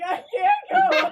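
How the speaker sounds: very high, loud, drawn-out whine; exaggerated despairing cry; mock upset wail. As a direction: wail helplessly, hammed-up, breaking into laughter. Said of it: "And then I got upset".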